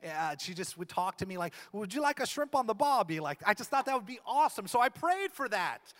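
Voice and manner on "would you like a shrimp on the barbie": playful bad Australian accent, pitched up; mock Australian accent. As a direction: imitate a wife with a hammy Australian accent. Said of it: "I know that's not your accent".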